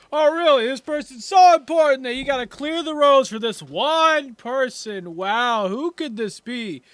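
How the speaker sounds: high, loud mocking scoff; sarcastic bystander. Annoyed man at the roadside mocking the guards, sarcastic. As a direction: sneer at the fuss with sarcasm. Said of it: "a man off to the side, just like".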